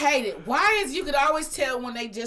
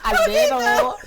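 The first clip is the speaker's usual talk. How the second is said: high, bright helpful reply; high bank-teller voice; 6 dB louder, much slower than usual. Retold or imitated. Teller answering Mexican customers, high and eager.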